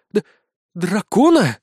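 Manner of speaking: stuttering, loud frightened question; horrified stammer. Acted. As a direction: stammer it in terror. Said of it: "с ужасом посмотрела". (в ужасе, заикаясь)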